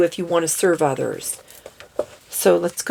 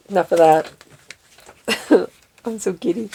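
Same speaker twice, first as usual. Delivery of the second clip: ordinary delivery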